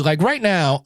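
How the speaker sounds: usual speaking voice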